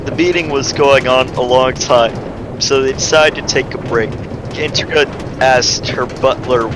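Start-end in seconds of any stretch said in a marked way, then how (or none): none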